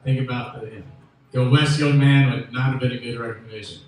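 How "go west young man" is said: put-on loud declaiming of an old motto; proclaiming the quote. Quoting the old frontier motto, loud proclaiming. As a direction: boom it like a frontier slogan.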